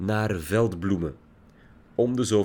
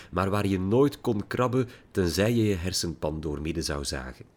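usual speaking voice

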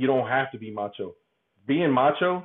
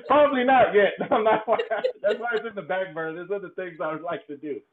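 real laughter, pitched much higher; laughing it off; 8 semitones higher than usual. Real, not acted. Guest dodging whether he wants to be there, laughing. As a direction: talk through your laughter. Laughs off being asked about crying.

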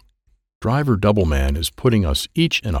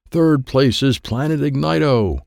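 announcer; 6 semitones higher than usual. Acted. Driver Doubleman on stage reading the race scores, announcing. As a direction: announce it grandly to a crowd.

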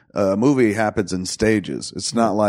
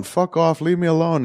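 higher-pitched imagined breakdown plea; mock outburst; 7 semitones higher than usual. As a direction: pretend you've snapped under endless questions. Imagined breakdown under constant questions.

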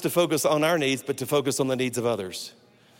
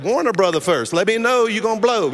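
louder, higher comic complaint; mock indignation; 5 semitones higher, 5 dB louder than usual. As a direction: scold the doctor for laughs. Acted complaint after the air puff.

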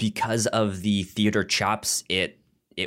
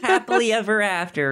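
mock fairy-tale gush; 12 semitones higher, 4 dB louder, faster than usual.

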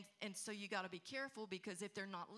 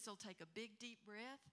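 ordinary talk, nothing marked

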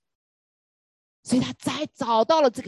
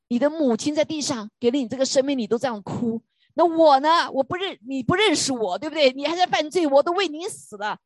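higher, pained reproach with a loud "那我呢"; a hint of a wounded God pleading; God speaking; 6 semitones higher, 3 dB louder than usual. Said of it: "神说".

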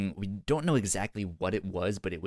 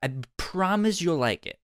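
put-on loud drunk pleading; 7 semitones higher, 8 dB louder than usual. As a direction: push the drink eagerly. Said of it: "she was like".

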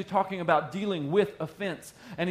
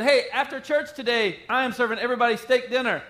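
mock announcement; 8 semitones higher, 7 dB louder than usual. Pastor jokingly announces steak dinner.

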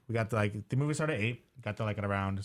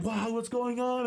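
surprised; 11 semitones higher, slower than usual. Retold or imitated. Other moviegoers curious.

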